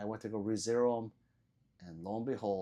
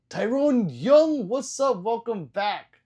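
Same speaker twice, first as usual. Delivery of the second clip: loud, high, stretched-out hello; big excited greeting; 11 semitones higher, 13 dB louder, slower than usual. Real, not acted. Streamer loudly welcoming back a regular viewer, hyped. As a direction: call out the name like a hype host.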